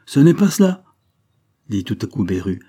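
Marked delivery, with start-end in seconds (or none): 0.1-0.8 loud, raised-pitch, firm interruption; almost harsh. A narrator's reading. Old guardian correcting the clumsy explanation, almost harsh. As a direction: cut in hard and high. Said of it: "d'une voix presque dure".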